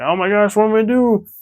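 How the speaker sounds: high cartoon fright; mock panic. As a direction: squeak it out like a scared cartoon hero. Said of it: "he's like".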